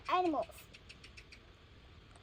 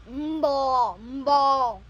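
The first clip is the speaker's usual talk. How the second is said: lower, louder cow moo; 4 semitones lower, 5 dB louder than usual. Pretend. Child reading a picture book, mooing for the cow. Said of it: "The cow say is".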